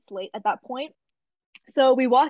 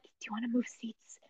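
whispered question to a friend; 12 dB quieter than usual. Retold or imitated. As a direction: whisper so the prof won't hear. Dead quiet class, tapping friend.

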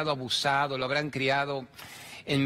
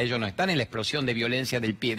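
ordinary talk, nothing marked

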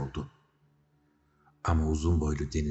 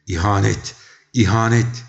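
two loud cries, the second higher; shrieked accusation; 4 semitones higher, 10 dB louder than usual. A narrator's reading. Big-mouthed queen shrieks treason twice, loud and rising. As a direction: shriek it out, climbing on the repeat. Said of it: "diye çığlık attı büyük ağızlı majesteleri".

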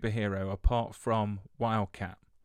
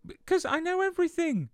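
mock boast.

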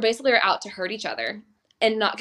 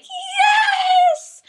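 high, loud cheering yes with a laugh; excited yes; 8 dB louder than usual. Retold or imitated. Cheering as the couple runs away, high and loud. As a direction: cheer like your ship just sailed. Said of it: "and I was like".

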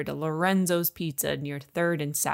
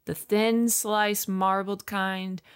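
solemn commandment reading; 3 semitones higher, much slower than usual. Acted.

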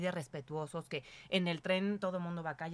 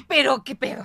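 1 exasperated outburst; 6 semitones higher, 14 dB louder than usual. Real, not acted. Exasperated about travelling with a toddler.

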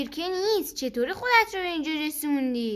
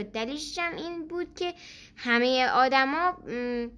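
plain reading voice

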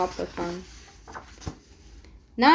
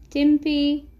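measured incantation, word by word; magic spell; 2 semitones higher, slower than usual. A narrator's reading. Elle casting a spell for a gift, incanting. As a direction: chant it like a spell, drawing out the end.

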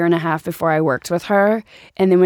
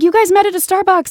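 teasing director; 12 semitones higher, 4 dB louder than usual. Retold or imitated. Director spilling the secret.

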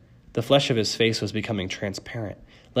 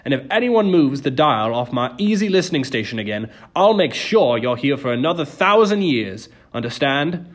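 louder, higher warning; threatening; 4 semitones higher, 8 dB louder than usual. A narrator's reading. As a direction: bark a threat at the crowd. Charon threatens the waiting room.

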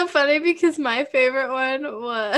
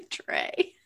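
normal talking voice

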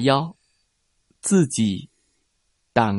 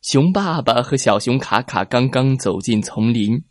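ordinary delivery, nothing marked

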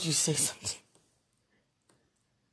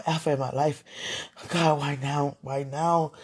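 ordinary talk

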